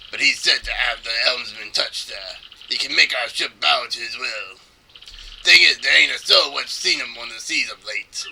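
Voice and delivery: put-on low-key pirate accent. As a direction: tell it like an old sea dog, in a pirate accent. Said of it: "that's not a bad, uh, pirate accent".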